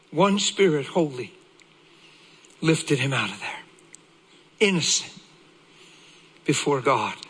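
low, hushed reverent sermon voice; grave, low-pitched pulpit delivery; solemn preaching. Real, not acted. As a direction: let each phrase sink in, with long pauses.

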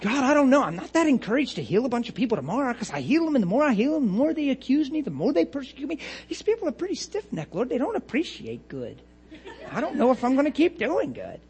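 mock-discouraged, swooping whine; whining Jesus. Imagined Jesus fed up with people, hammed-up whine to God. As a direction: feel sorry for yourself, silly and moaning. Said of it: "Could you imagine Jesus talking like that?".

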